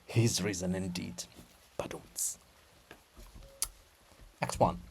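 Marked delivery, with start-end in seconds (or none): 1.8-2.4 soft whispered drum sting; mouthed badum-tss; whispered rimshot. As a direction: tick off a rimshot. Rimshot joke.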